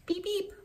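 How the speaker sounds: soft horn noise; breathy toy-car beep; quiet car horn imitation; whispered beep beep.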